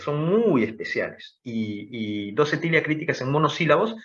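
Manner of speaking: ordinary talk, nothing marked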